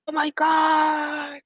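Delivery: high, slow panicked "oh my God"; high-pitched dismayed gasping disbelief; panicked reaction. Retold or imitated. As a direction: act out the shock of realizing what you did. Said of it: "One can be".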